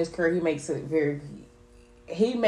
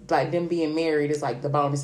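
usual speaking voice

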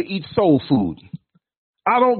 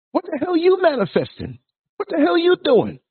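exasperated; 9 semitones higher than usual. Real, not acted. Ranting at so-called manifesters.

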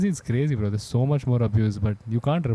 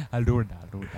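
ordinary talk

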